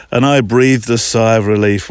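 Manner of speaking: ordinary delivery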